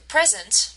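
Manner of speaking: "present" slowly, as PRE-sent; first-syllable stress. Shown as a demonstration. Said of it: "the stress is on the first".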